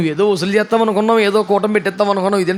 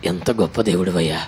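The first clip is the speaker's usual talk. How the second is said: grateful woman; 13 semitones lower, 4 dB quieter than usual. Retold or imitated. Woman thanks Jesus, retold sermon.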